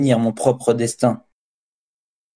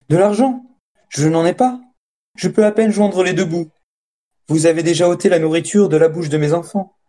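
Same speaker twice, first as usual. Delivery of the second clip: higher-pitched, pleading protest; 5 semitones higher than usual. A narrator's reading. Farmer protesting to the extortioner that he has nothing, protesting. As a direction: plead poverty in a raised pitch. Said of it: "Il protesta".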